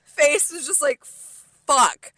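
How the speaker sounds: loud, high retold panic at the table; loud dismay. Reliving the dread at the table.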